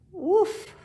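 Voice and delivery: loud high woof for the crash; crash sound effect. Acted. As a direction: burst out the crash noise. Tree crashing down.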